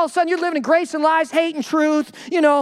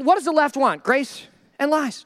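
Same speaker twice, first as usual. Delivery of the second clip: ordinary talk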